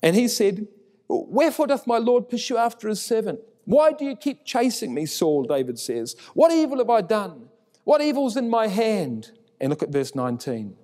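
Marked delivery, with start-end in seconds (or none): 1.1-5.4 higher, pleading call; shouting down. Acted. David pleads with Saul across the valley, high and calling. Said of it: "shouting down the valley". 6.3-9.3 high protesting call; shouting down. Acted. David protests his innocence to Saul, a high call. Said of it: "shouting down the valley".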